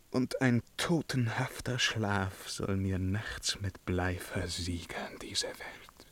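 slow and soft, dying away into a whisper; fading to a whisper. Acted. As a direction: let the voice sink as if into leaden sleep. Sinks to deathly quiet.

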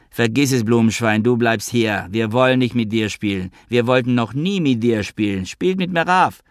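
usual speaking voice

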